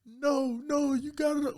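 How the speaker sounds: squeaky high mock woman's voice; high-pitched Jane voice. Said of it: "she's like".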